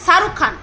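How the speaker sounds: শাহরুখ said with a dental s, Saharukh as sarukh; Sati's pronunciation. Retold or imitated. Vlogger imitates Sati's dental s in শাহরুখ Khan. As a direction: mimic Sati saying শাহরুখ with s instead of sh. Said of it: "তালিবর্ষটাকে ও দন্তস্ব উচ্চারণ করে".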